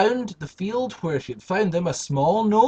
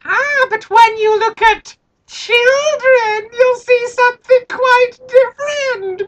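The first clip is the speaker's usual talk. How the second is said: high, loud, slow creepy gnome; sly gnome; 9 dB louder than usual. Acted. Gnome hinting what children really are, high and sly. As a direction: tease like a sly little gnome. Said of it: "gnome speaks".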